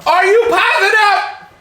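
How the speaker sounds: high, loud demanding question; playful shouted challenge. Pretend. Husband challenges wife over sugar, playful shout. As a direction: bellow it like a playful sergeant. Mock drill-sergeant challenge to partner.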